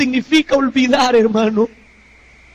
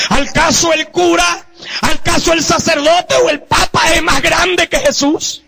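preaching at full force; 5 semitones higher than usual. Real, not acted. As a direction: thunder it out from the pulpit. (predicación a todo pulmón)

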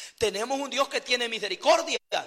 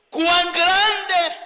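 higher and louder, full of wonder; admiring exclamation; 6 semitones higher, 7 dB louder than usual. Shown as a demonstration. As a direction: exclaim it in awe like the psalmist.

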